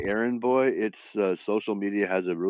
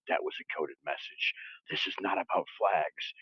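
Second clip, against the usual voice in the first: hushed, low conspiratorial voice, much softer; conspiracy theorists; 6 semitones lower, 9 dB quieter than usual. Retold or imitated. Mocking the internet's whispered conspiracy theory. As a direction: mutter it like a secret code cracked. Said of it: "half the internet's like".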